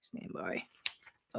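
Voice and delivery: very low, hushed mutter; barely voiced, dropped right down; under the breath. Real, not acted. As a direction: repeat it almost inaudibly to yourself. Muttered repeat while searching.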